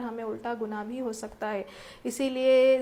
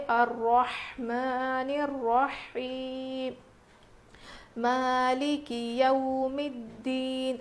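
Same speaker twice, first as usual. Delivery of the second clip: slow, drawn-out recitation voice; tajweed recitation; much slower than usual. Shown as a demonstration. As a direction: recite it as a model for students. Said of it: "اسی لیے ہم پڑھیں گے". (تلاوت کے انداز میں آہستہ پڑھنا)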